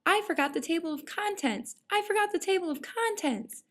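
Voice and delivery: high-pitched mock panic; crying out. A narrator's reading. Jack the narrator realizes he forgot the contents, crying out. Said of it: "cried Jack the narrator".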